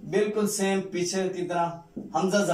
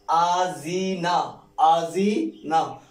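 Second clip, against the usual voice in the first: slow blending; much slower than usual. Shown as a demonstration. Teacher blending letters for a pupil, very slowly. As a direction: stretch the joined word out very slowly. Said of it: "teacher blends syllables slowly".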